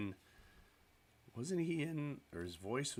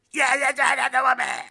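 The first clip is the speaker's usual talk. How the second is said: much higher, much louder rough radio-DJ howl; Wolfman Jack impression; 18 dB louder than usual. Streamer mimicking the legendary radio DJ for viewers, loud rough howl. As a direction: belt it out like a wild late-night DJ. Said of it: "And he talked like this".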